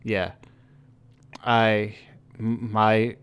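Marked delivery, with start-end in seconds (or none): none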